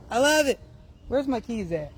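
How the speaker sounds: ordinary talk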